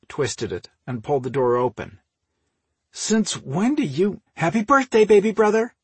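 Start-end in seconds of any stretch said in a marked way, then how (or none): 2.9-4.2 higher, drawn-out puzzled question; incredulous and high; surprised question. A narrator's reading. Surprised question, cut off by greeting. 4.3-5.7 high, loud and gleeful; big excited birthday greeting, pitched way up; grinning announcement. A narrator's reading. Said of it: "Ruth announced, with a huge grin".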